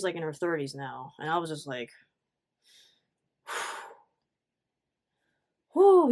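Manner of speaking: normal talking voice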